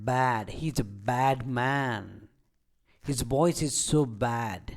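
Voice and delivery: slow phrases with "bad" stretched to "baad"; teacher's slow model of long "bad"; long vowel. Shown as a demonstration. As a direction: draw out the open "a" in every bad. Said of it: "ଲମ୍ବା ଉଚ୍ଚାରଣ କରିଥାଉ".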